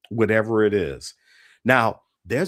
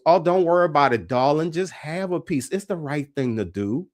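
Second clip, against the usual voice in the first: imitating an Uptown New Orleans lady, higher; lady sharing her king cake in Uptown New Orleans dialect; 6 semitones higher than usual. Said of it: "I gotta get the right dialect".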